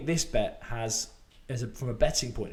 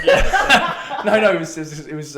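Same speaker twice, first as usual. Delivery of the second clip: laughing; 5 semitones higher, 13 dB louder than usual. Real, not acted. Laughing at own bad bet.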